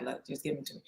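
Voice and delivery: soft, hushed request; soft urging whisper-quiet plea; gentle hush. Retold or imitated. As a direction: coax it out quietly, almost under the breath. Elijah quietly asks for the son.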